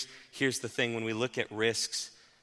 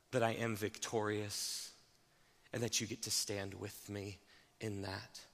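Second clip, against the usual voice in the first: Jesus' calm reassurance; 3 semitones lower, 8 dB quieter than usual. Acted. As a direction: draw each phrase out to calm the listener. Jesus' words voiced by preacher.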